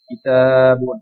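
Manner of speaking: কিতাবুন said loud and clear, each sound shaped; teacher models কিতাবুন loud for the class; clear Arabic model word. Shown as a demonstration. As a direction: pronounce কিতাবুন carefully for students. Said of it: "আমি শব্দগুলো একবার উচ্চারণ করে যাচ্ছি".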